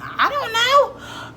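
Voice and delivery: slow high mock despair; exasperated. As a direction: wail it, totally lost. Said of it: "and I'm like".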